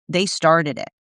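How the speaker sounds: a mild hint of a sulky, defensive person; half-hearted imitation of a blamer; defensive excuse. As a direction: lightly voice someone blaming others. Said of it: "the sound of trauma and someone being like".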